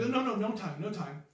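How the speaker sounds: high, hurried refusals; flustered Seneschal. Acted. Seneschal herding adventurers out of the room, flustered urgency. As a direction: brush them off urgently.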